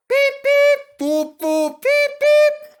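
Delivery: very high, loud beeping whistles; whistle imitation. A narrator's reading. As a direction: toot like excited engines. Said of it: "The two engines whistled excitedly".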